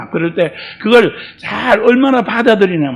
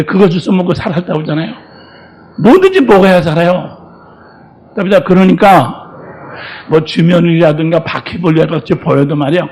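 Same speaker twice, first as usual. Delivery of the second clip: usual speaking voice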